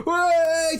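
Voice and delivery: high, loud whoop; cheering crowd. Acted. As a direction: cheer like a tavern full of patrons.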